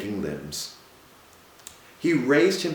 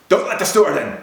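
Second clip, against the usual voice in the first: urgent call; 9 semitones higher, 8 dB louder, faster than usual. A narrator's reading. Captain urgently warns the doctor.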